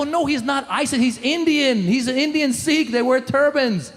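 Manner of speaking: acted exasperated correction, pitch up; flustered correcting. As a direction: set a child straight in comic alarm.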